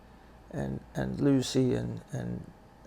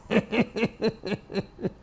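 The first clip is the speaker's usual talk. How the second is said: high-pitched run of laughter; high chuckle; 9 semitones higher than usual. Real, not acted. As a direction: chuckle at your own expense, high and staccato. Laughs at his own admission.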